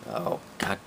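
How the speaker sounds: normal talking voice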